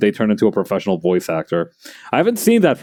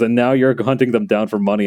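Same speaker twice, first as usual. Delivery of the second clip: ordinary delivery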